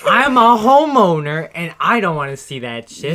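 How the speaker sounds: loud, high outraged homeowner; angry homeowner. Acted. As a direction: rant like a fed-up homeowner. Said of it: "It's part of the joke".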